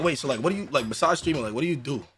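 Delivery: ordinary talk, nothing marked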